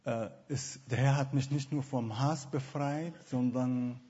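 normal talking voice

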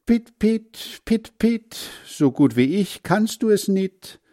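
put-on high, chirpy bird voice teasing the boy; sing-song it like a cheeky bird; mocking blackbird.

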